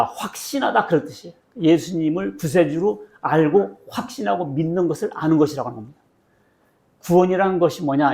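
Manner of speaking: ordinary talk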